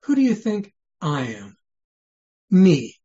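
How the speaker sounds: weight on "I", driven home with "Me"; stress on I. Shown as a demonstration. Preacher voicing Jesus asking about himself, stress on "I". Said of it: "Or what if the emphasis was".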